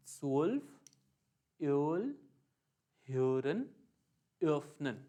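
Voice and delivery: स्वोल्फ, योल, ह्योरन, öffnen with a rounded ee-o glide; O umlaut drill. Shown as a demonstration. Teacher repeating the examples for students, deliberate glide. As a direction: separate words, rising on ह्योरन, falling on öffnen. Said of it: "दोबारा बोलते हैं".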